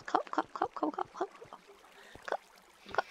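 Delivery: short hiccup-like cop cop bursts; hiccuping copper. Acted. Gamer making copper hiccup, choppy cop cop. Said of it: "copper sound like when it has hiccups".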